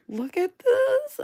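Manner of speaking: can't contain the delight; delighted squeal. Real, not acted. Gushing over owl sticker.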